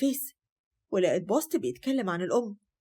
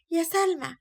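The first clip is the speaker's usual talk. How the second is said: calling again, pitched up higher; second, higher call; 8 semitones higher, slower than usual. Acted. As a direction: call her name again more insistently. Grandmother calls granddaughter twice.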